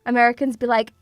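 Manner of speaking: normal talking voice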